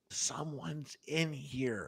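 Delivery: very quiet, drawn-out frightened whimper; imitated tearful girlfriend. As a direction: say it hushed and slow, imitating someone crying. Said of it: "it sounds like she's crying".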